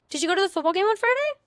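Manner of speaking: put-on high, loud, quick question in a boy's voice; a high, fast role-play question; curious guy. Shown as a demonstration. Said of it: "here, let's do this".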